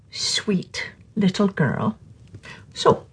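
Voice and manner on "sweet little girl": slow, secret whisper. Acted. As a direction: lean in and whisper it slowly. Bedtime story teaser.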